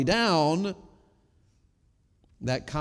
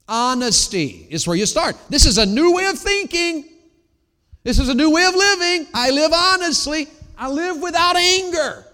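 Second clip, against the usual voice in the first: much higher and louder preacher's cry; full-force preaching; 12 semitones higher, 9 dB louder than usual. Acted. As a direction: lift it to a ringing peak. Preacher peaking on new life.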